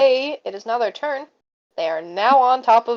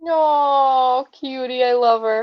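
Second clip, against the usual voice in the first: higher, drawn-out affectionate aww; adoring coo; 4 semitones higher, slower than usual. Real, not acted. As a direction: fawn over a cute little character. Cooing over the character.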